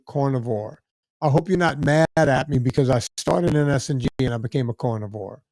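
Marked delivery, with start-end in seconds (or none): 0.1-0.8 "carnivore" said like "cornivore"; Louisiana accent. Real, not acted. Speaker naming a diet, "carnivore" sounding like "cornivore". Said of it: "it sounds like you're saying cornivore". 4.7-5.4 "carnivore" pronounced as "cornivore"; a low, soft "carnivore" with "corn" as its first syllable; Louisiana accent. Real, not acted. Host quoting a dieter, "carnivore" sounding like "cornivore". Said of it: "it sounds like you're saying cornivore".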